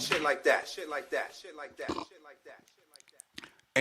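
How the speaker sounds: fading out. Acted. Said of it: "fading repetition".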